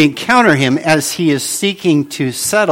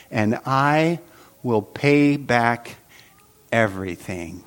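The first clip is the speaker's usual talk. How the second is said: slow deliberate reading; 3 semitones lower, 3 dB quieter, slower than usual. Shown as a demonstration. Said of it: "I want you to notice the exact words".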